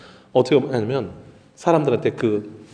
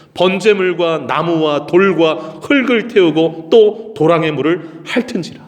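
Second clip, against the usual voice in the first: high, loud, drawn-out declamation; dramatic scripture reading; 4 semitones higher, 4 dB louder, slower than usual. Acted. Preacher reading how the Lord's fire fell, dramatic declamation. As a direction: proclaim the verse with slow weight.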